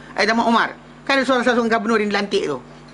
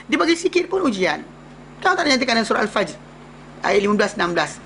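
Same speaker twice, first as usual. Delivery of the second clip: normal talking voice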